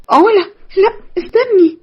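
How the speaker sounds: high, panicked begging; startled cry for her to stay; panicked plea. Acted. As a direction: beg her friend not to leave. Nermin begs Ola not to leave.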